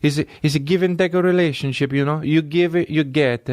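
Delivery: high, insistent Italian caricature; mock Italian accent. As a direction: explain earnestly as an Italian guy. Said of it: "Italians go like".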